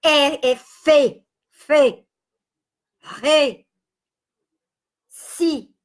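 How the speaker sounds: "fe", "re", "si" said slowly with a closed vowel, as single syllables; syllable names sounded out. Shown as a demonstration. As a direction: sound out "fe, re, si" one by one. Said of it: "ele no tradicional é fechado". (letras soletradas com som fechado: "fe", "re", "si")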